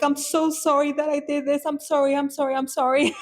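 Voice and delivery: high, repeated apologies in retold panic; panicked apology. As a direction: act out apologizing over and over, panicking. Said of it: "Like, panicking, right?".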